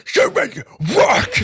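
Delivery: high, slow, wailing "Stupid work"; very loud cry. Shown as a demonstration. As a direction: belt it out at full voice. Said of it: "blew out all of everybody's ears".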